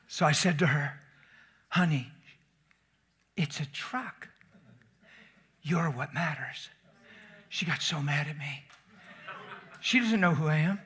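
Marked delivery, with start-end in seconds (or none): 1.7-6.7 soft, slow reassurance; preacher retelling comforting a woman; tender. Retold or imitated. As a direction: gently soothe a shaken woman.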